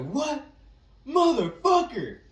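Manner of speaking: screamed high and loud, drawn out; yelling. Retold or imitated. Yelling at someone who hit his car. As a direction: explode in a shocked yell. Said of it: "as I yell".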